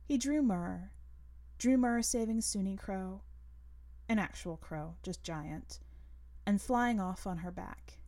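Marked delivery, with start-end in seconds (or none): none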